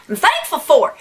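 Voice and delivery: usual speaking voice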